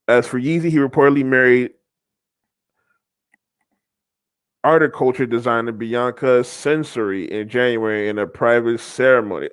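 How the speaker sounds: low, measured reading voice; article read-aloud. Acted. Host reads divorce report to viewers, article read-aloud. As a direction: read it off the page, pitched well down.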